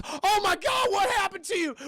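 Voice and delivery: high, loud horrified cry. Retold or imitated. Corey sees what Doctor Mitten Spider became, horrified cry. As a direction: shriek it like you've just seen a monster.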